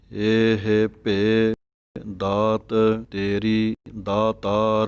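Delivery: very slow, ਭੇ drawn out clearly. Shown as a demonstration. Teacher models ਭੇ for students. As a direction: stretch ਭੇ with the sihari sound. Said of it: "ਭੱਬੇ ਸਿਹਾਰੀ ਭੇ, ਇਸ ਤਰ੍ਹਾਂ ਆਵਾਜ਼ ਕੱਢਣੀ ਹੈ".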